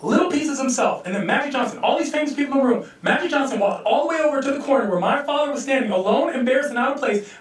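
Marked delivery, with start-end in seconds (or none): none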